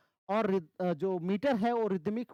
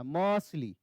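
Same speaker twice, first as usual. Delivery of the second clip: मर्सली stressed on म, dropping off on ली; stress on first syllable; 3 semitones lower, slower than usual. Shown as a demonstration. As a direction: press on म, go light on ली in मर्सली. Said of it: "म पे ज्यादा जोर आ रहा है". (मर्सली में म भारी, ली हल्का)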